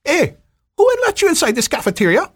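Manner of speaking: high, loud angry demand from the Hawaiian lady; mock-angry Hawaiian lady calling out in a high loud voice; angry challenge. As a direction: snap at the intruder in a high loud voice.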